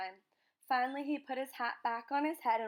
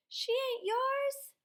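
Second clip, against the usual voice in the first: very high, slow, shrieked protest; boy screaming as his sister is taken; 14 semitones higher, 5 dB louder, much slower than usual. A narrator's reading. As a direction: scream it at the father. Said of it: "Bryce screamed".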